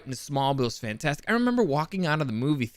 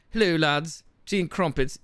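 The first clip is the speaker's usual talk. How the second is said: hammed-up British greeting; British accent; 3 semitones higher than usual. Shown as a demonstration. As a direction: try out a cartoonish British accent. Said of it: "Should I do the British accent?".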